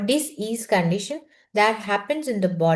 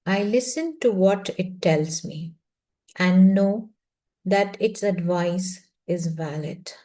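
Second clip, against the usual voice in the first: slow affirmation with long pauses between phrases; very slow reading; 2 semitones lower than usual. Shown as a demonstration. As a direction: let each word sink in, meditatively. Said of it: "I'm going to read this very slowly".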